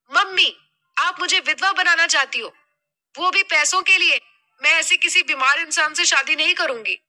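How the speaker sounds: high-pitched daughter in indignant protest; irritated outburst. Acted. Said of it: "Megha खींच उठी". (बेटी का चिढ़ा हुआ, ऊँचा विरोध)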